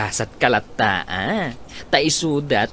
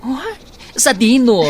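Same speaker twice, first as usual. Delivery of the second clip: surprised; 11 semitones higher, 6 dB louder than usual. Acted. Friend surprised at news.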